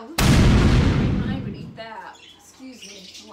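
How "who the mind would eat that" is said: loud, slow disgusted cry; disgusted outburst. Real, not acted. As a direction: blurt out your disgust at the taste. Reacts to fermented herring smell.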